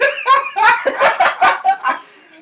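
high, loud cackling laughter; loud laughing fit. Real, not acted. Gamer cracking up with a friend, high loud laugh. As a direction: laugh uncontrollably. Cracks up after losing bet.

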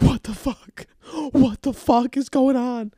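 high-pitched, freaked-out dog voice; panicked dog. Acted. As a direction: squeal it as a bewildered dog.

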